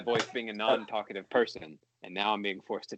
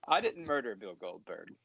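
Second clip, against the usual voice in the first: ordinary talk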